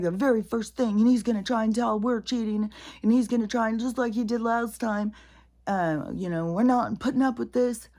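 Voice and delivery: high, loud mocking politician voice; imitating Kamala. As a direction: parody her shrill speech. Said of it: "And she's like".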